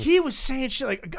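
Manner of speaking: ordinary talk, nothing marked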